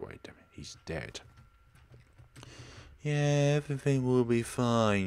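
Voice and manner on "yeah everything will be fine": loud, higher sarcastic mimicry; mocking quote. Mocking dead Jimmy's promise after the explosion, sarcastic. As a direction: throw his words back at him scornfully. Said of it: "you say".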